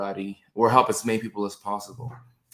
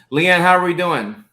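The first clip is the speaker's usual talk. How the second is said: excited greeting; 7 semitones higher, 9 dB louder than usual. Real, not acted. Greeting a viewer by name.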